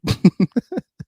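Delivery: sudden high disbelieving laugh; burst of laughter. Real, not acted. Reacting to her tweets, a high disbelieving laugh.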